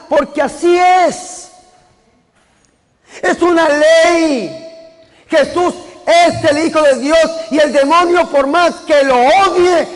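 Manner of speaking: fervent pulpit preaching; preaching at full force. Acted. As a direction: preach it to the congregation with fervor. Preacher raises his sermon.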